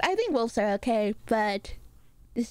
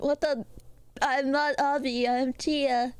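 ordinary talk

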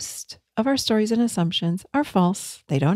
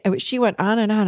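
usual speaking voice

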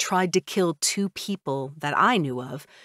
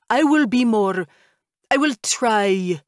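louder vow that slows into a humble try; vampire father backing down; 4 semitones higher, 6 dB louder than usual. A narrator's reading. Vampire father correcting his own promise to the narrator, from loud vow to slow humble try. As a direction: start the promise big, then slowly soften it.

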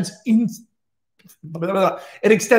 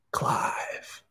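whisper; 10 dB quieter than usual. Acted. Old guard whispers Clive's name.

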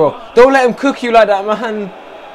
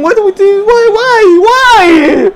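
despairing outcry; 7 semitones higher, slower than usual. Real, not acted. Fan despairing at a whipped cross, wailing outcry. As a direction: build from a high protest to a yelled why.

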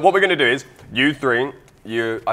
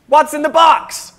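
bad put-on American accent, high and loud; hammy American announcer voice; fake American accent; 11 dB louder, slower than usual. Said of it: "That's my really bad American accent".

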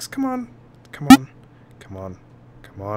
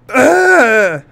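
high, loud gasping shriek; mock panic scream; 11 semitones higher, 13 dB louder than usual. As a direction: gasp then scream in pretend fright. Panics at dying in the box.

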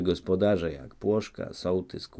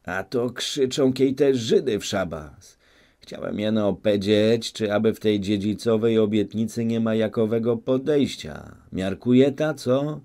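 a hint of an old man's scolding, louder and higher; stern rebuke; 4 semitones higher, 6 dB louder than usual. A narrator's reading. Old Płoszka silences the noisy crowd, stern and louder.

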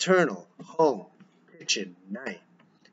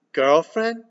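surprised; 4 semitones higher, 5 dB louder than usual. Acted. Father surprised by news.

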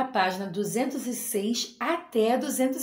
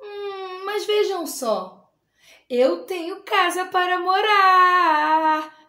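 high, slow and loud with a teary catch, snail delighted; proud discovery; 6 semitones higher, 4 dB louder, slower than usual. Acted. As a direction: realise with joy you have a home. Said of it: "mas depois ele pensou". (o caracol, emocionado, percebe que tem casa)

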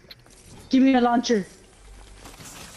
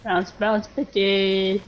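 ordinary talk

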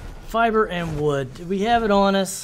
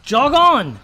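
loud, high bark to chase it away; shouting it off; 5 semitones higher, 6 dB louder than usual. Real, not acted. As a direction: yell at it to clear off. Shouting off an attacking creature.

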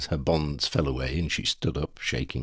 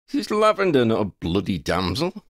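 indignant; 6 semitones higher, 4 dB louder than usual. Acted. Ron indignant retort.

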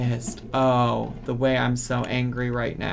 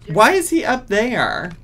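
1 exasperated shout; 8 semitones higher, 7 dB louder than usual. Real, not acted. Yelling at Sims game.

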